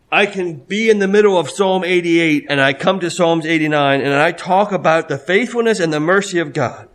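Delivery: ordinary delivery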